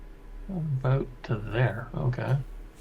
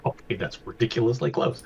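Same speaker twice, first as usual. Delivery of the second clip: usual speaking voice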